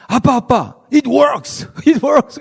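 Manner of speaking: excited daughter rushing in; 딸 목소리로 높게 신나서. Retold or imitated. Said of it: "그러면서 얘기하는 거예요". (리디아가 흥분해서 높은 목소리로)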